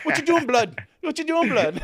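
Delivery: high, loud mock London slang voice; London street accent. Imitating a Londoner calling him out in London street accent. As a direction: bark it like a London roadman. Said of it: "I don't want Troops to be like".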